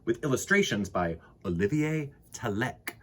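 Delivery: ordinary delivery, nothing marked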